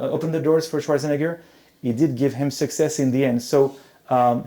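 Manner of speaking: normal talking voice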